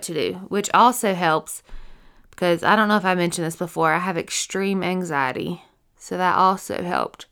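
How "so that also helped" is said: yawning. Real, not acted. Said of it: "You yawned again".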